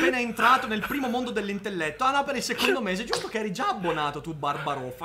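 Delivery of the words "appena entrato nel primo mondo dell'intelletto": louder mock-grand announcement; loud announcer. Real, not acted. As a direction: announce it with playful pomp.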